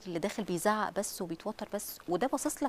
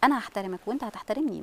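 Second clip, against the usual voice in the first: normal talking voice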